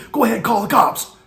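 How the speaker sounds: loud, high furious challenge; angry dare. Retold or imitated. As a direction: taunt him to call the cops. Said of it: "he starts getting really angry".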